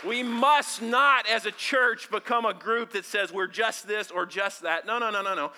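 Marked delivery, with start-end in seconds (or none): 0.0-1.3 slow, loud, deliberate; emphatic. Real, not acted. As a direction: lean hard on each word. Preacher builds to emphatic declaration.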